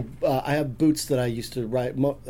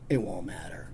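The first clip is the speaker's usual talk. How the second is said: low, soft film-scene delivery; resigned reply; 6 semitones lower, 7 dB quieter than usual. Retold or imitated. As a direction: say it quietly, with finality.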